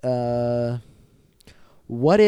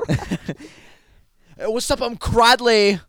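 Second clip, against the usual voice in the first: cocky bully; 8 semitones higher, 6 dB louder, much slower than usual. Acted. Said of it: "His name is Cradley".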